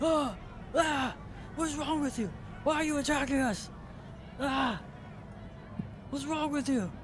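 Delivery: high-pitched, alarmed protest; taken aback. Acted. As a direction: recoil and squawk in shocked indignation. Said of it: "Charn is kinda taken aback".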